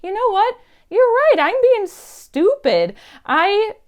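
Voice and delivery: high, loud imitation of sudden excitement; lit-up realisation. Lady realising she can dress goth, imitated excited revelation. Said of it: "she just lit up and was like".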